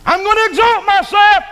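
high shouted boast; proud shout. Acted. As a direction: shout it full of pride.

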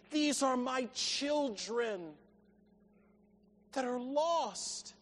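slow appeal fading to very soft; a father yearning, hushing on the last words; heartfelt longing. Acted. God tenderly longs for lost children.

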